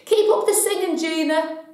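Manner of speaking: high, loud parting yell; shout. Retold or imitated. Stranger yelling encouragement as the singer drives off, loud shout. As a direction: shout it after a car pulling away. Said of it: "a shout at the top of her voice".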